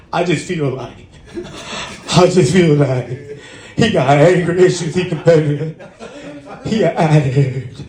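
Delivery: lightly voiced whiny girlfriend, laughing; a hint of a mocking girlfriend voice, half-laughing. Mocking girlfriend's complaint to therapist.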